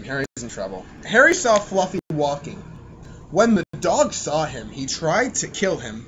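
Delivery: ordinary talk, nothing marked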